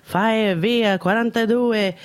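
high, slow Italian kitchen order; slow, high barked Italian order; Italian chef call. Retold or imitated. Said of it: "telling them what they needed in Italian".

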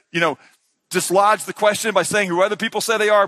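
usual speaking voice

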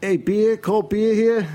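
high, loud hawker cry; beer vendor call. Shown as a demonstration. Teaching Ann how a beer vendor calls out in the stands. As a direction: sell cold beer to the whole stadium. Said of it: "teach Ann how to be a, a beer vendor".

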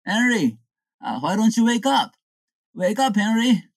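high, insistent call to a sleeper; wake-up call. Acted. Prankster waking Henry amid the hamburgers, wake-up call.

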